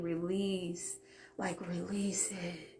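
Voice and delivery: soft, breathy whisper of an inner voice. Retold or imitated. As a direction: hush it like a voice in your head. Her higher voice speaking inside.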